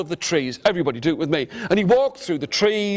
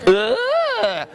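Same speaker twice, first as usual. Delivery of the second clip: high, drawn-out "ugh"; hammed-up high noise of disgust; disgusted squeal. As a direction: squeal as if stuck in slime. Stepping into sticky mud.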